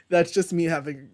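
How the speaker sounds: ordinary delivery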